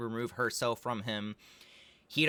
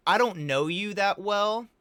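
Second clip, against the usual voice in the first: high, louder, rising at the end; mock wary refusal; 8 semitones higher, 5 dB louder than usual. Imagined reply to a pop star's invitation, mock wary. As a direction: balk at the invitation in a raised, questioning pitch.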